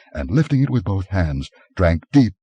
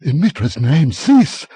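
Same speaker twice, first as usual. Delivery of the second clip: high, loud desperate plea; begging; 10 semitones higher, 6 dB louder, slower than usual. A narrator's reading. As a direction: beg him to stop, frantic. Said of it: "begged Publio".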